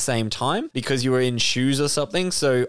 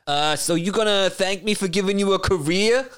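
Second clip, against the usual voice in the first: Joe Pesci; 6 semitones higher than usual. Acted. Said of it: "Joe Pesci's first thing he says".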